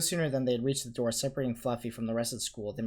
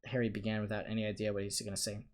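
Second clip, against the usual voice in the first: ordinary delivery, nothing marked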